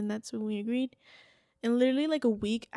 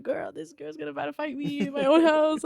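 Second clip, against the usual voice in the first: higher, louder retold alarm; mock panic; 5 semitones higher, 7 dB louder than usual. Calling her best friend before a fight, high panicky retelling. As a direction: act out a frantic call for backup.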